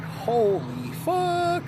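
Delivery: high, slow startled curse; shocked out of nowhere by a cat; cat leaps on the desk, he blurts in shock; startled gasp. Retold or imitated.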